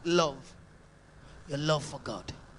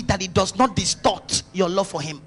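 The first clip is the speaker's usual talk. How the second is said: ordinary talk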